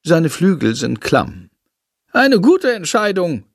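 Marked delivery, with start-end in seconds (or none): 2.1-3.4 bat praising. A narrator's reading. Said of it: "lobt er Jendrik".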